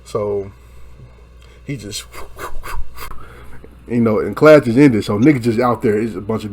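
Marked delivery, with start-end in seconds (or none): 2.1-3.8 breathy puffed blow sounds; imitated punches. Showing how the taller dude hit him, imitated punches. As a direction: mimic repeated hits with bursts of air. He just, then imitated blows.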